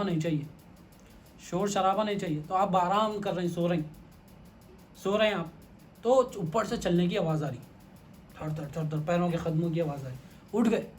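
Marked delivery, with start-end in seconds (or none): none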